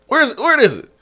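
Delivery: high, urgent repeated question; excited asking. Retold or imitated. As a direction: fire the question up high twice. Buck asking, retold.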